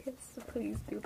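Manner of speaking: whispering. Real, not acted. Pleading aside for a pet.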